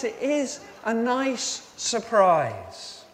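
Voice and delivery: ordinary talk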